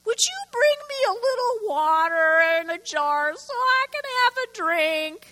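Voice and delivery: preacher voicing the prophet asking for water, high; high voice for Elijah. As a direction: play Elijah asking politely, high and light. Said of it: "He called her and asked".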